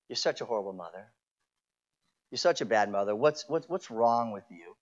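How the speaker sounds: low, soft, mocking inner accuser; evil whispering voice. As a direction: hiss the accusations quietly like a tempter. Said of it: "that evil voice whispering to you".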